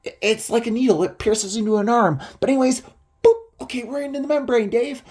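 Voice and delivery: high, quick imitated patter; mock a man excitedly narrating his gadget tricks; Don. Don guiding Dave through the tiny hole, high excited patter. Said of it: "He's like".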